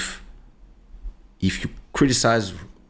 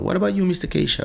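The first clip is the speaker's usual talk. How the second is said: higher, fast, breezy waiter's question; waiter asking; 9 semitones higher, much faster than usual. Retold or imitated. Waiter turning to the father to take his order, high and quick. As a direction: rattle it off like a busy waiter. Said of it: "And I was like".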